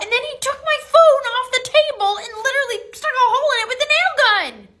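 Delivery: high, loud and upset; worked-up, aggrieved complaint; distraught outburst. Acted. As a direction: blurt out the outrage at high pitch. Upset student recounts broken phone.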